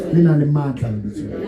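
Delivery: low, measured prayer in short phrases; feed a prayer line by line for repeating; leading prayer. Real, not acted. Leads a repeat-after-me prayer.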